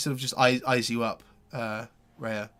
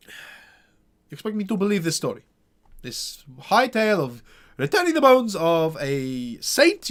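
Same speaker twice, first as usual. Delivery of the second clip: pompous burgomaster; 6 semitones higher, 7 dB louder than usual. Acted. Burgomaster doubts the tale.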